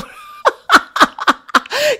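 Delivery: long high-pitched laugh; high laughing fit. Real, not acted. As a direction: crack up high. Laughing at cute face.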